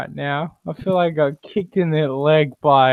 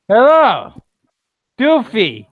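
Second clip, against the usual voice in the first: excited greeting; 9 semitones higher, 7 dB louder than usual. Real, not acted. Greeting a friend joining.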